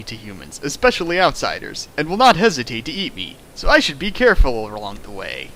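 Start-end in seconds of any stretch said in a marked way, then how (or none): none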